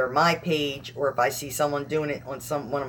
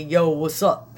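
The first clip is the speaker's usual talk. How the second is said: put-on South Philly accent; 7 dB louder, slower than usual. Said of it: "you have to hear it in this South Philly".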